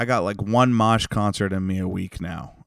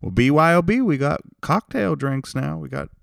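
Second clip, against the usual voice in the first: much higher friendly sing-song voice; a hammed-up sweet restaurant lady; imitating the waitress; 7 semitones higher, 4 dB louder than usual. Podcaster imitating the nice restaurant owner, high sweet voice. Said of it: "but she's like".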